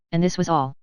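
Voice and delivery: ordinary delivery, nothing marked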